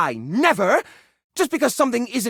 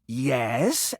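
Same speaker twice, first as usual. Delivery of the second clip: usual speaking voice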